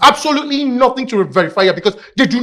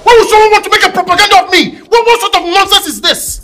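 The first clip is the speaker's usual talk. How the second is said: loud, high, exasperated; angry outburst; 5 semitones higher, 5 dB louder than usual. Acted. Son loses patience with his teasing brother, loudly angry. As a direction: snap at him to stop, fed up.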